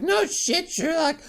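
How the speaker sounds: high-pitched mom retort; mock a mother's sarcastic comeback. Mom's sarcastic comeback to tribe.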